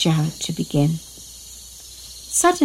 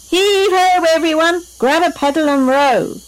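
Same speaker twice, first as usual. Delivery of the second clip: high, strong heave-ho to the crew; energetic pirate rallying cry; rallying call; 9 semitones higher, 4 dB louder than usual. A narrator's reading. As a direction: urge the rowers on with a pitched-up call. Captain rallying crew to row.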